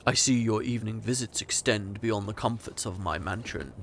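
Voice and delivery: low, soft, ominous voice; menacing stranger. Acted. Mysterious stranger interrupts lovers.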